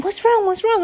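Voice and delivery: high mock shout at ordinary volume; retold shouting. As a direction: imitate a worried friend calling across. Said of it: "she's shouting over to me".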